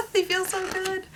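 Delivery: high-pitched gushing; giddy delight. Real, not acted. Vlogger gushing over how samples feel, giddy delight. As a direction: squeal it lightly, delighted.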